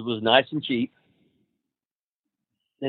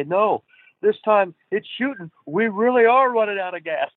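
much higher mock-serious Garvin voice; imitating Garvin; 10 semitones higher than usual. Rip imitates Garvin faking an emergency in the plane. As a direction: fake alarm as Garvin in the plane.

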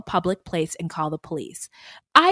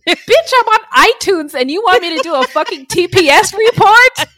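loud, high, sassy mock rant; hammed-up high sassy rant; sassy diva; 11 semitones higher, 10 dB louder than usual. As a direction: play the diva telling off the boss. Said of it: "like, I'm at work".

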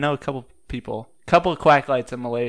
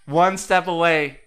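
slow, drawn-out announcer build; game show host; 2 semitones higher, 4 dB louder, slower than usual. Acted. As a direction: announce the winner grandly, slow.

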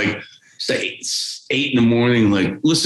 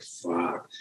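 breathed-out low curse, almost silent; hushed stunned swear; awed quiet curse; 5 semitones lower, 13 dB quieter than usual. Retold or imitated. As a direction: let it fall out under your breath in awe. Awestruck reaction to Randy.

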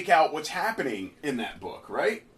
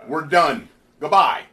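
mock farewell; 3 semitones lower, 6 dB louder than usual.

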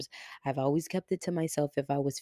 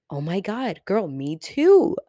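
excited; 3 semitones higher, 7 dB louder than usual. Retold or imitated. Acted quote of followers relating.

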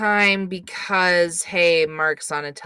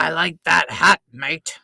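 Australian accent, growl it and let it fall away; gruff Australian accent; 3 semitones lower than usual. Shown as a demonstration. Showing the co-host the gruff Australian accent, falling low. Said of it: "'cause they're gruff".

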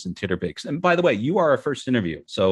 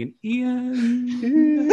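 laughing announcer intro; 13 semitones higher than usual. Pretend. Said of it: "I'll change the introduction".

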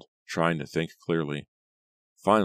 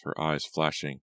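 plain reading voice

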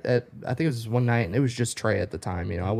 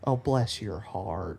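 slow, softened Southern mom voice; sweet, unhurried Southern motherly sympathy; 2 semitones lower, 5 dB quieter, much slower than usual. Retold or imitated. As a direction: draw it out gently like a Southern mom. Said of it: "Southern moms".

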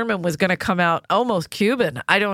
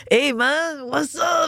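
put-on voice; 7 semitones higher, much slower than usual. High put-on greeting voice.

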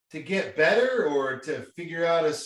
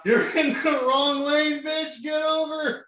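retold panicked scream; 9 semitones higher, slower than usual. Boyfriend in withdrawal yelling at her driving, high mock scream. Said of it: "you were screaming and panicking".

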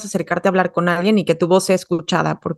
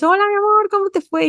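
much higher, sing-song happy greeting; excited mom greeting; 12 semitones higher than usual. Retold or imitated. Mom picking up child from school, high excited greeting. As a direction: gush at your child like you missed them all day. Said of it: "tú llegas emocionada, feliz".

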